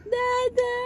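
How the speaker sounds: put-on a very high, loud "Dada" for the child to copy; high model word. Shown as a demonstration. Mother models "Dada" for her toddler, very high. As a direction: squeal "Dada" as a prompt. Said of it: "One more".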